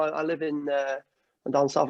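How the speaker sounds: normal talking voice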